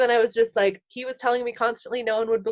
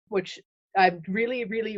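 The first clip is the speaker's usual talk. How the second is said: usual speaking voice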